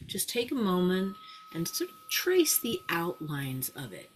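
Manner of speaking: quiet hypnotic induction voice; slightly hypnotic voice. Shown as a demonstration. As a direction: ease them into a light trance. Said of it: "I use that slightly hypnotic voice".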